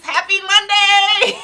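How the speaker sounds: high, loud, drawn-out cheer with a laugh; excited greeting. Real, not acted. Vlogger cheering happy Monday to her viewers, excited and loud.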